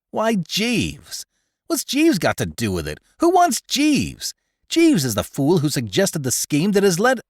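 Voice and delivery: bristling at the very idea; indignant protest. Acted. Corky protests to Bertie about bringing Jeeves, indignant.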